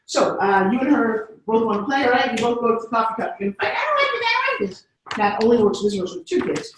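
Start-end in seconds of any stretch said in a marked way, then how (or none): 3.8-4.8 put-on high whining child fighting over a toy; much higher mock child complaint; whiny kid. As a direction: whine it like a small kid. Imitating squabbling kids.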